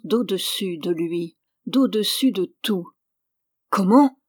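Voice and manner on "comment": loud indignant burst; outraged cry. A narrator's reading. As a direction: cry out in disbelief at the servant. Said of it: "cria monsieur David".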